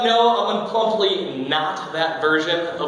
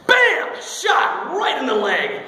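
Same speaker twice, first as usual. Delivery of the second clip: sudden exclamation; 7 semitones higher, much slower than usual. Retold or imitated. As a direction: blurt it out like a surprise jab. Acts out sudden injection.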